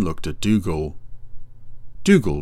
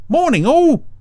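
very high, loud sing-out greeting; cheery Brian; 6 dB louder than usual. Acted. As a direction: call out a greeting in a high character voice. Brian greets everyone in a story.

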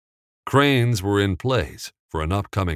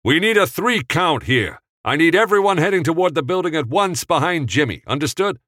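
loud, high, fast orders; megaphone instructions; 10 semitones higher, 4 dB louder than usual. Acted. Director instructing extras through a megaphone, loud and high. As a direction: drive the crowd through the megaphone. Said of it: "pulled the megaphone up to his mouth".

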